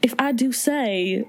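slow, lightly put-on Southern drawl; a hint of a Southern belle; Southern accent. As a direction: ham up a genteel Southern voice. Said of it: "pretending to be Southern".

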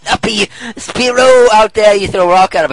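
loud, high riff; excited outburst. Acted. Host joking about the bird outside, loud and excited.

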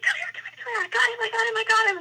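very high, urgent squeal; high-pitched, overwhelmed rapid repeats; retold emotional excitement. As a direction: re-enact frantically beckoning the friend after success. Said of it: "I'm emotional".

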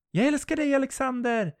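high, loud loving call. Acted. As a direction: call across the water to him. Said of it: "ropar Jennifer". (Jennifer ropar högt till Alexander)

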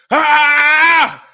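loud, drawn-out yell of pain; pained scream. Real, not acted. Suffering through the timed challenge, pained scream. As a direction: let out a long "ah" scream.

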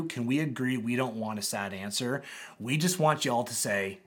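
ordinary talk, nothing marked

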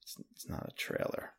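very quiet, nearly whispered let-down; retold disappointment. Coworkers breaking bad news, retold in a hushed, dejected voice. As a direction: trail it out softly, crestfallen. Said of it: "both had a sad face".

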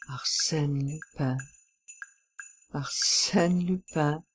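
soft, slow mutter; stunned mutter. A narrator's reading. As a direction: mutter the name slowly to yourself. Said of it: "he muttered".

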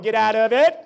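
loud barked father command; retold military father ordering son up; mock drill order. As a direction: bark it like a military dad.

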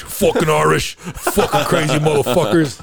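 put-on Scottish rant; Scottish accent. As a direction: imitate a Scotsman bitching. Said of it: "all he did is bitch about the Irish".

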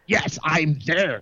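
slow, lightly put-on voice; stretched-out mock answer in an altered voice; demonstrated voice. Shown as a demonstration. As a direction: draw it out as a show of your voice. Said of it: "So my voice is".

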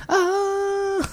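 shrill roller coaster scream; high pitched yell. Shown as a demonstration. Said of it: "That's how I yell".

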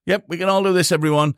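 high, cheeky imitation of a kid; mock kid voice. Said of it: "kids watching this thinking".

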